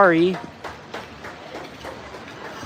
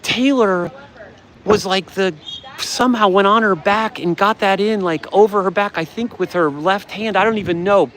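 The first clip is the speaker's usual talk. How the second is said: ordinary talk, nothing marked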